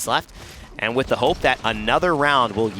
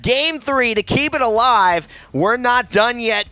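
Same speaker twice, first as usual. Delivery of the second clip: screaming the play, much higher and louder; all-out hype caster yell; excited shout; 4 dB louder, slower than usual. Real, not acted. Caster calls the win.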